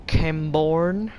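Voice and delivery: slow, drawn-out American twang; American accent. Pretend. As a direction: say it like a Western movie town. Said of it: "You sound American".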